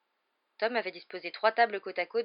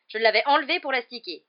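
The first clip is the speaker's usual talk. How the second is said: loud, raised angry protest; furious yell; 6 semitones higher, 13 dB louder than usual. A narrator's reading. Percy explaining his lost badge, furious yell. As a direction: shout it in outrage. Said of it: "hurla-t-il".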